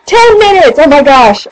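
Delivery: high, loud burst of surprise; suddenly shocked at the time; startled. Real, not acted. Shocked at elapsed recording time.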